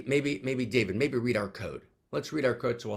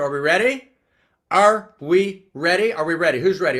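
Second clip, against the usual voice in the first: loud, rising cheerleader rally; hyped call to the room; rallying cheer; 4 semitones higher, 11 dB louder than usual. Real, not acted. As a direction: pump up the crowd.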